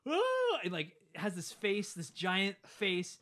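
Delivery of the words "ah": very high loud mock yell; a sudden cartoon villain cry; monster shriek. The monster being frozen, mock shriek.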